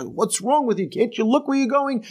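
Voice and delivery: high-pitched indignant rant; hammed-up road-rage outburst, pitch climbing; mock yelling. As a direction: act out yelling at a careless driver. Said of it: "yell and scream and kick the tires".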